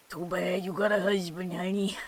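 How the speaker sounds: put-on old New York man accent. Teasing someone with a husband, old New York man accent. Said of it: "My old New York man accent".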